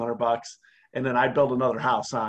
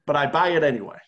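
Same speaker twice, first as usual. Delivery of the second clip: normal talking voice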